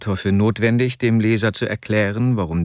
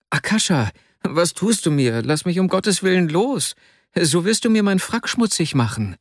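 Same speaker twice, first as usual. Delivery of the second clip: higher-pitched, pleading protest; protesting Vasja; 7 semitones higher than usual. A narrator's reading. Weaker friend pinned by Arkadi, high-pitched protest.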